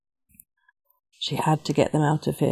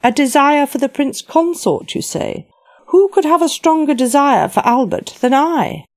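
fervent queen voice; 9 semitones higher, 4 dB louder than usual. Acted.

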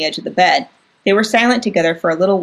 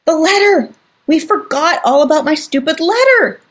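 high, loud outburst of realization; sudden alarm; 9 semitones higher, 3 dB louder than usual. Acted. Sister remembering the forgotten letter, alarmed outburst. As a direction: blurt it out in a panic.

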